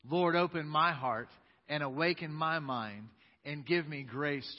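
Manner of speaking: loud, slow group recitation of the creed; creed with authority. Acted. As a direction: proclaim it together with conviction. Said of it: "Let's say this with authority".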